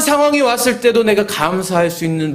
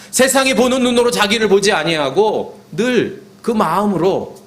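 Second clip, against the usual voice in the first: raised, insistent pulpit delivery; preaching exhortation; 6 semitones higher than usual. Acted. Preacher urging the congregation to build faith routines, exhorting. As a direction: drive each phrase home in a higher voice.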